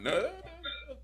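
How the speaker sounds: high, loud shouted command; mock Vince order. Vince ordering Apollo to slap Big E, hammed-up high shout.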